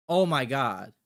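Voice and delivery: normal talking voice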